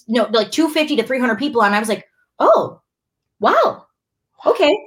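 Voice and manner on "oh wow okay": much higher, louder amazed oh wow; amazed at the hundreds watching live; stunned surprise. Retold or imitated. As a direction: gasp it out high, amazed. Surprise at the viewer count.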